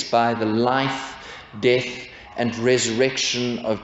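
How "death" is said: ordinary talk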